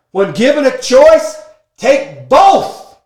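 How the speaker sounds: loud and high, hyped up; excited shout. Real, not acted. Speaker to audience, hyping secret five, excited shout. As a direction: punch it out like a rally cry.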